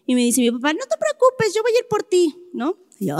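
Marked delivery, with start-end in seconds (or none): 0.7-2.3 dad's promise voiced much higher; high, light promise. Retelling how dad promised to fetch her, high and light. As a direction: lightly sing out the reassurance.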